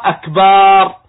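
"أكبر" pronounced akbaar with a long final vowel; lengthened ba. Shown as a demonstration. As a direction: hold the aa after B in "أكبر". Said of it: "فيمد الباء وكأنه ينطقها".

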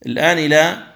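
usual speaking voice

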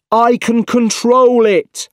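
high, loud, slow insistent shout. A narrator's reading. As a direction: shout over the chaos, stretching the words. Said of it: "shouted Otto".

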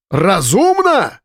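high, slow, outraged echo of the word; incredulous shout. A narrator's reading. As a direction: drag out Разумно as a shocked yell. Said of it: "его голос сорвался на крик".

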